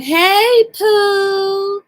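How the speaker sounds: high, loud sing-song hey Pooh; Foodie's greeting. Retold or imitated. Retelling how Foodie greets her squad, high. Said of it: "Yeah, I messed that up".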